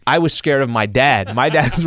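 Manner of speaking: usual speaking voice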